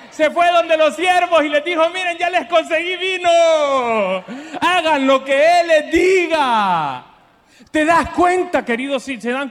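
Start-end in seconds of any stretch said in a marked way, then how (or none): 0.1-7.0 preaching shout. Acted. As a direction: proclaim it to the whole hall. Predicador voceando a María. (grito de predicador, agudo y fuerte)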